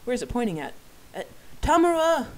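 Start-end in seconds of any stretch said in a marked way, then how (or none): 1.1-2.3 sudden high cry of alarm. Acted. Narrator sees the knife aimed at his friend and cries out. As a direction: shout the realization in panic.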